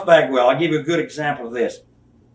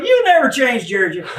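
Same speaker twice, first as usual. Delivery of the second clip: much higher, louder teasing lament; mock sigh at Jerry; 8 semitones higher, 5 dB louder than usual. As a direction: tease Jerry like he's hopeless.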